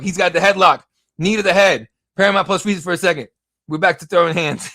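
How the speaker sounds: loud, excited fight call; live play-by-play. Acted.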